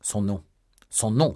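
building narrated exclamation; crying out. A narrator's reading. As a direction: exclaim it, pushing harder the second time. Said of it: "s'écria D'Artagnan".

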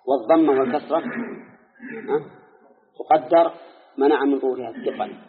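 ordinary delivery

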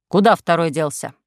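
stern policeman. A narrator's reading. Policeman character voiced.

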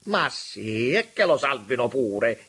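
mildly heated exclamation; exclaimed. A narrator's reading. Auriti exclaims about saving Selmi, heated. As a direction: concede it with a loud burst. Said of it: "esclamò Giulio Auriti".

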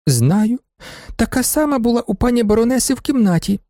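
put-on high childlike voice; little girl. A narrator's reading. Little girl answers the judge, in a high child's voice. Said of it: "спитав суддя Гелюню".

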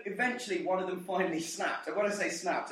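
normal talking voice

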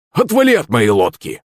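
straining angry yell. A narrator's reading. Захарыч fending the shark off his boat, straining to yell. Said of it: "надрывался Захарыч".

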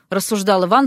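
plain reading voice

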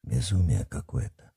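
low, soft aside; under his breath. Acted. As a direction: mutter it half-voiced. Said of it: "полголоса согласился с ним Гордей".

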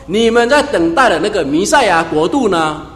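提高嗓门，像耶稣对百姓大声宣告; 一句句往上顶，带着急切责备; 激动宣讲. Retold or imitated. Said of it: "他说". (耶稣对众人宣讲，声音高亢响亮)

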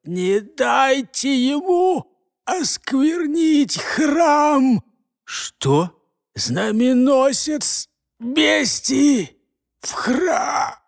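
strained, high, slow dying shout; gurgling alien voice. Acted. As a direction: force it out through gurgling lungs. Dying prisoner, lungs gurgling.

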